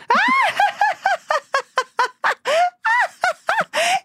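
high shrieking burst of laughter. Real, not acted. Laugh at the punchline.

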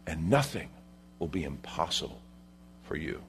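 very soft, low, spaced-out phrases; hushed emphasis. Real, not acted. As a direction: let the promise sink in quietly. Preacher drops voice for promise.

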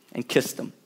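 whispered, tender ending. Acted. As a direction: let it fall to a whisper. End of scripture reading.

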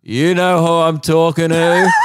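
laughing mock yell at the stage; mock heckler shout. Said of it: "And then I go".

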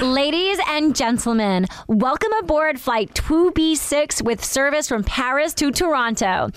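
slow, assured American cabin announcer; convincing American accent. Acted. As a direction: read it slowly like an American flight announcement. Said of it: "you're doing American".

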